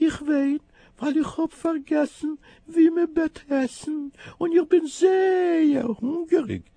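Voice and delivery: very high, slow, plaintive kitten voice; high slow crying kitten. Acted. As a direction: whimper it like a starving kitten. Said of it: "macht die Kuh".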